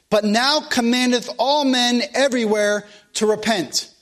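preacher reading the Bible to the congregation; scripture reading. Acted. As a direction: proclaim each verse from the pulpit. Reading scripture verses aloud.

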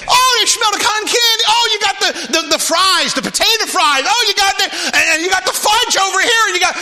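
put-on high, loud kid voice spotting treats; excited kids. Preacher acting out kids drawn to boardwalk food, high and loud. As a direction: gush like a child who sees the fudge. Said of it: "those kids are gonna be like".

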